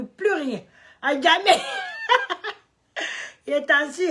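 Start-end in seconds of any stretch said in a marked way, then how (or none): 1.6-2.5 high burst of laughter. Real, not acted. Laughs at her own joke.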